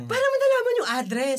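high, startled ask of mom; shocked question. Retold or imitated. Son, shocked that mom found the apartment, asks in a high startled voice. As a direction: react like she caught you off guard. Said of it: "Tas sabi ko".

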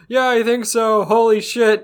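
high, loud breathless hammed-up answer; mock shaken reply. Answering her companion after the fall, in a high, loud mock-shaken voice. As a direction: play the rattled partner over the top. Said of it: "They're like".

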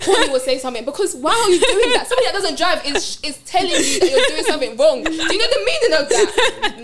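loud, high ranting through laughter; road rage. Real, not acted. Venting to friends about a back-seat driver, loud rant. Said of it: "Road rage".

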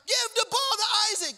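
high, full-volume coach's yell; shouting coach. Retold or imitated. Coach to team after Isaac scores, shouting. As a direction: holler the order across the court. Coach yelling orders again.

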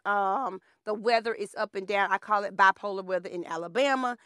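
ordinary delivery

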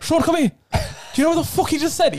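very high, indignant Irish mimicry; mock the teacher calling over a colleague; pissed Irish teacher. Angry teacher summons Sean, high hammed-up Irish outrage. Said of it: "that's how you know he's pissed".

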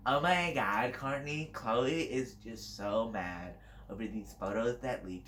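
put-on slow, drawn-out Valley Girl drawl. Shown as a demonstration. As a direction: stretch it out like a gossiping Valley Girl. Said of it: "There's the Valley Girl".

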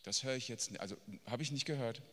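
low, quiet, offhand brush-off; mock dismissal. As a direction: pretend politely not to have heard. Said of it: "Jesus sagt nicht". (leise, abweisend gespielt)

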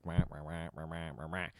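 garbled, droning mock chatter; muddled wah-wah noise. Dad imitating bickering that fades out for him, muddled wahs. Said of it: "it's just all muddled, like".

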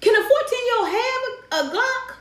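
high, stunned question; incredulous. Real, not acted. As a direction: ask it in disbelief. Shocked by mother's choice.

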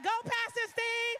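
loud, high, slow cheer. Retold or imitated. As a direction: egg him on from the sidelines. Cheering husband on.

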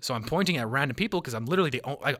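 usual speaking voice

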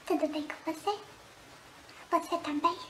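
soft. Pretend. Intimate aside to one viewer.

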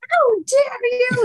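mock outrage. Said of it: "Morgan was like".

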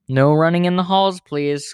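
loud storybook reprimand; called out. A narrator's reading. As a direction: call down the hallway to runners. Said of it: "called Mister Bundy".

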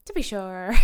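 put-on stock Irish brogue; mock Irish accent. Host answers co-host's film question, mock Irish accent. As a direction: answer as a jokey stage Irishman. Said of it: "do the whole podcast in Irish".

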